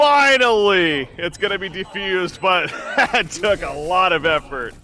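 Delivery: loud, slow, drawn-out cheer; excited relief. Real, not acted. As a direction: release it all, then laugh. Relief after clutch defuse.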